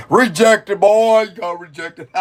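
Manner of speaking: loud teasing jab; mocking tease. Real, not acted. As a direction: rub it in playfully. Teasing the rejected character.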